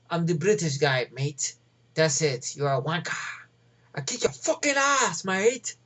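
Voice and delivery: mock British tough-guy accent; hammed-up British action-star impression; Statham. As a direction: put on a cartoonish British hard-man threat. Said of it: "That's Statham".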